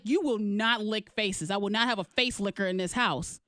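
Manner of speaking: slow, stern parental scolding; dressing-down. Retold or imitated. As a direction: lay down the law to a child. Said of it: "You dressed him down".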